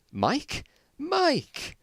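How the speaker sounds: shoots way up on the second Mike!; surprised recognition. Acted. Mike spotting his lost friend, crying out in surprise. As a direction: disbelief turning into a joyful cry.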